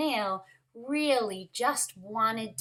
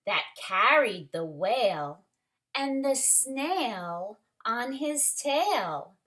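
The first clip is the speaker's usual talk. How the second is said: put-on lively read-aloud for children; story reading; 4 dB louder than usual. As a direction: read it like a picture book to the class. Story narration continues.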